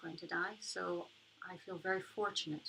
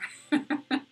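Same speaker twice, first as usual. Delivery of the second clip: breathy laugh; 8 semitones higher, 12 dB louder than usual. Real, not acted. Laugh after joke.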